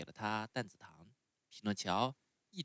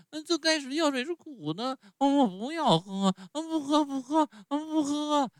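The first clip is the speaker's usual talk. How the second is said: much higher, louder wailing tantrum; crying and yelling; 10 dB louder than usual. A narrator's reading. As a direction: bawl it out like a child in a tantrum. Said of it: "匹诺乔大哭大叫".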